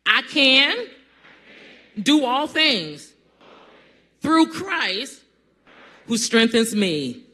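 I can do all things shouted high and loud. Shown as a demonstration. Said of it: "you didn't say it like I said".

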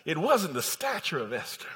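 normal talking voice

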